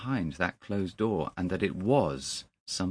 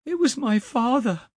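very high, loud, stricken cry; horrified cry; 6 dB louder than usual. A narrator's reading. Young man cries out on coming round, horrified. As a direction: cry out in shock. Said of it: "he cried".

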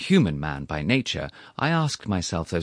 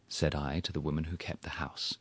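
whispering; 4 semitones lower, 10 dB quieter than usual. A narrator's reading. Narrator's aside.

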